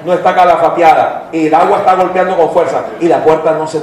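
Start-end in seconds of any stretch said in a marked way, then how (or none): none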